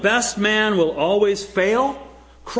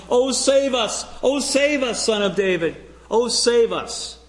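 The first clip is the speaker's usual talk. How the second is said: raised and higher, crying out for rescue; pleading cry; 7 semitones higher than usual. Retold or imitated. Preacher voicing the crowd crying to Jesus, pleading cry. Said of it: "cry out to him".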